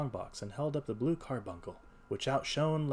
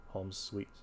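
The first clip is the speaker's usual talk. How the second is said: usual speaking voice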